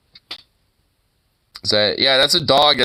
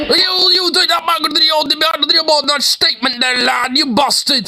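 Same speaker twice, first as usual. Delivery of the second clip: mock Irish accent; 12 semitones higher than usual. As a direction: rant like a furious Irish TD. Imitating Irish politicians arguing.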